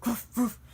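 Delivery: two short puffed barks; imitated dog bark. Storyteller mimicking the dog heard again, barking. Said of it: "I hear the barking again".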